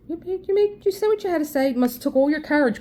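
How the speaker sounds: high, sarcastic mock praise. To the neighbor's son, high mocking sarcasm. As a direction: talk down to him in a high taunting voice.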